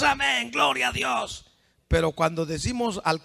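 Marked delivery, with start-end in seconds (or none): 0.0-1.5 higher, mock praise cry; raised-pitch show of praise; mimicked congregation cheer. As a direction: imitate a cheering churchgoer.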